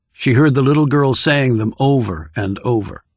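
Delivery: ordinary delivery, nothing marked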